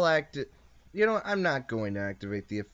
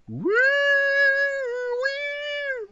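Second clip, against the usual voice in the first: long, high-pitched "wooo"; whooshing imitation; 4 dB louder than usual. Sending his opponent's monster into the future, high drawn-out woo. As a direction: draw out a high woo like a time-travel effect.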